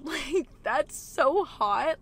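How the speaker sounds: slow laughing gush. Real, not acted. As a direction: laugh through the words. Laughing at swooning moment.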